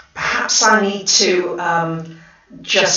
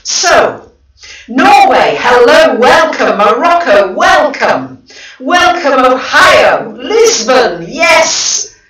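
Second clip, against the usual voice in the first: loud, high welcomes; thrilled greeting, louder; excited welcome; 4 semitones higher, 5 dB louder, slower than usual. Real, not acted. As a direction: beam out each welcome to viewers. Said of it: "Gosh, I'm so excited".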